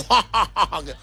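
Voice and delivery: hammed-up ketoprak stage laugh; theatrical laugh. Pastor parodies a stage hero facing a demon, theatrical laugh.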